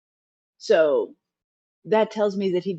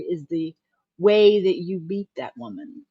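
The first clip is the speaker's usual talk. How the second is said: ordinary delivery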